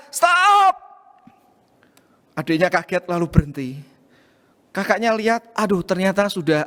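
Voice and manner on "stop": sharp high yell to halt him; shout. Retold or imitated. Older sister yells at her little brother to stop scribbling, high loud shout. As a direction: bark it out loud and high, a child's alarmed stop. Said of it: "langsung berteriak".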